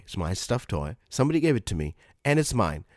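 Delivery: normal talking voice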